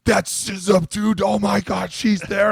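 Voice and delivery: high, excited mock bro gush; excited teammates. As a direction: imitate the guys gushing over her. Guys excited about chick on TV.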